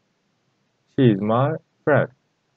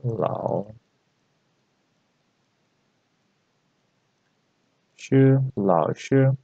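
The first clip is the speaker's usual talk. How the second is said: slow drill; 3 dB quieter, slower than usual. Shown as a demonstration. Teacher drills the word slowly.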